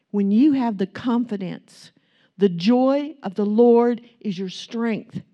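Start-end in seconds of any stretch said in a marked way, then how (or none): 2.4-5.2 preaching. Acted. Preaching at full force.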